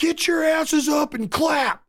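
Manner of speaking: high, slow, put-on bellow of a rowdy New York fan; fan yelling at the crowd. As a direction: stretch it out like a fan barking orders at the stands. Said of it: "He just looks up and says that to everybody".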